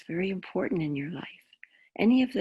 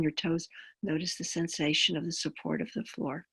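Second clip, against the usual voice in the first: calm grounding exercise; 5 dB quieter than usual. Acted. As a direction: guide them gently into the body.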